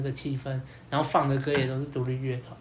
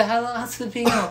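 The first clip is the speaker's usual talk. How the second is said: excited about ice; 8 semitones higher than usual. Retold or imitated. Repeating her excitement.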